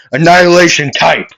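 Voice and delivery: fast, high, loud battle shout; yelled attack call. Acted. Midoriya yelling out his move as he connects. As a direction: bellow the finishing move. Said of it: "he would yell out".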